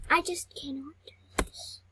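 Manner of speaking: whispered despair; real whispered defeat. Real, not acted. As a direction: mutter it under your breath, defeated. Falling behind in game.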